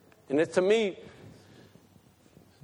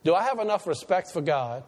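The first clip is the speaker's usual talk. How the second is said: usual speaking voice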